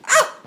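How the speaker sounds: short, very high cry of pain; hammed-up high "ow" yelp; mock yelp. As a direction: yelp as if slapped. Wizard gets slapped in the cheek.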